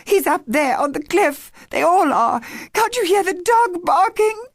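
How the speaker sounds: high, loud, pleading panic; desperate to make them hear; girl begging her family to hear the dog, frantic. A narrator's reading.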